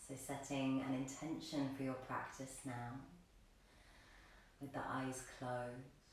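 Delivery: soft, low meditation voice with long pauses; guided meditation. Real, not acted. Yoga teacher helping the class set an intention, hushed guided meditation. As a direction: gently guide them inward, leaving space to breathe. Leads students in setting an intention.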